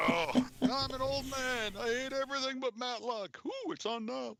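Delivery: put-on high, creaky grumpy old man. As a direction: play a cranky old grandpa. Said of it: "I gotta try to get my grandpa voice".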